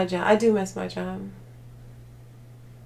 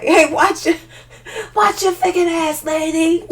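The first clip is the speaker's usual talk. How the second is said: higher, louder laughing yell; yelling back; 9 semitones higher, 5 dB louder than usual. Retold or imitated. Vlogger acting out yelling at a rat, higher laughing yell. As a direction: snap at the rat like a street tough. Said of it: "Yell back".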